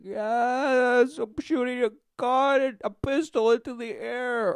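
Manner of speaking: high, goofy voice for the guy firing into the air; mock gunman. A guy firing his pistol into the air, crowing in a high goofy voice. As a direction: play him high and silly, gleefully firing shots. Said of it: "the guy's like".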